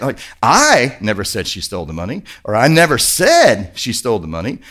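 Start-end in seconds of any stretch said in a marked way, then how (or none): 0.4-2.2 "I" stretched and punched, the rest dropping low; emphatic "I", then lower; stress on I. Shown as a demonstration. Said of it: "it all depends on how you say it". 2.5-4.6 emphatic "said" with a break after it; stress on SAID. Shown as a demonstration. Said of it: "it all depends on how you say it".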